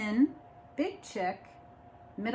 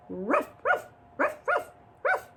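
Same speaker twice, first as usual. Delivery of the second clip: playful dog barks; mock dog barking. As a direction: bark like the grumpy dog.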